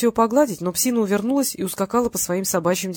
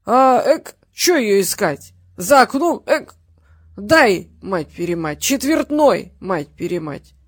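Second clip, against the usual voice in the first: put-on drunk ranting; 5 dB louder, slower than usual. A narrator's reading. Drunk father demands money from his daughter, hiccuping and swearing. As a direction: rant like a drunk demanding cash.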